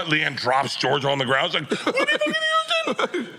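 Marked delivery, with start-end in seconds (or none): 1.8-3.3 very high, drawn-out squeal; mock starstruck shriek. Wife drops her daughter on seeing the celebrity, mock squealed shock. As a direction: squeal in slow, hammed-up disbelief.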